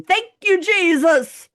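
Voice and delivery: high, loud triumphant yell; mock scream of joy. Sheldon after his strike, a high loud joyful yell. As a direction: belt it out like a bowler who just struck. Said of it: "jumps up and down ... screaming".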